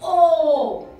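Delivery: rounded ओ called out to the class; vowel sound o. Shown as a demonstration. Teacher to young students, demonstrating the vowel, rounded o. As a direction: say ओ with round lips. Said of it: "क्या आवाज होती है इसकी".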